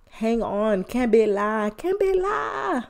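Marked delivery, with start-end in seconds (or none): 1.8-2.8 sing-song the catchphrase back up high; high drawn-out repeat. Acted. Podcaster urging listeners, stretched high and slow.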